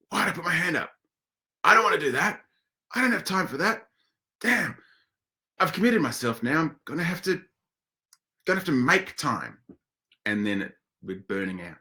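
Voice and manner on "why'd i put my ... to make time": much higher, fretting mock grumble; hammed-up annoyed at herself; regretful self-talk. Presenter acts out her inner voice regretting saying yes. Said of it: "afterwards you go".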